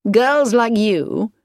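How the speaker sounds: slow, sulky complaint; complaining. A narrator's reading. A young man sulking at his sweetheart, complaining. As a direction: grumble it, drawn out and slow. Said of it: "Edward complained".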